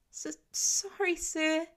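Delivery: put-on a nervous boy stutters a sorry; stuttering apology. A narrator's reading. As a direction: stammer it out, frightened kid. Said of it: "the boy stutters out".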